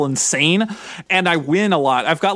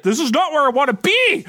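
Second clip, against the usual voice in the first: frustrated player; 9 semitones higher than usual. Retold or imitated. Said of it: "It's like".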